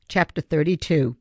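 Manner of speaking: plain reading voice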